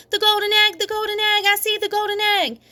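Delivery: high, thrilled outburst; excited blurt. A narrator's reading. Junie spotting the golden egg, high and thrilled. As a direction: blurt it out in delight.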